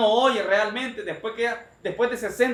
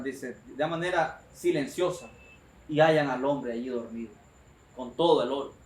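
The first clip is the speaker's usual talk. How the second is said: ordinary talk, nothing marked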